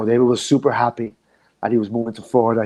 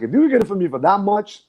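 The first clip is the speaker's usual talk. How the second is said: retold eager promoter, much higher; lightly voiced keen promoter, pitched up; mock eager reply; 8 semitones higher, 4 dB louder than usual. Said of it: "He was like".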